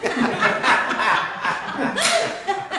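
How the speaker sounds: high burst of laughter. Real, not acted. Laughing while telling the story to friends. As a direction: laugh out loud at the memory.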